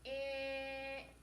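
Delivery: "P, ick" split into two sounds, held long. Shown as a demonstration. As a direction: tap out "P" then a stretched "ick". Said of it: "Tap these sounds".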